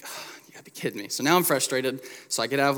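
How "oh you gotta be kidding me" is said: whispered, fast mock disbelief; exasperated aside. The pastor reliving being stopped at the bag check, under his breath. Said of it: "I'm like".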